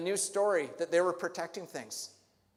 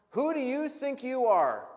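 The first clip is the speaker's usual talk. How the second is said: higher and louder, outraged; furious demand; 7 semitones higher, 6 dB louder than usual. Acted. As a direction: hurl it at him. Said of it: "this righteous indignation".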